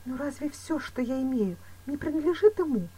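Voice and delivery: a touch of tremble, pleading; faltering voice. A narrator's reading. Woman doubting her lover's motives, voice faltering. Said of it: "спросила Луиза дрогнувшим голосом".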